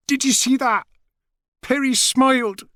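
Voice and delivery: a mother bursting with joy at her son's smile; thrilled mother. Acted.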